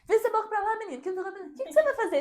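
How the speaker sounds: imitating the boyfriend scolding her at bedtime; scolding reply. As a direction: brush off the bad omen, scolding.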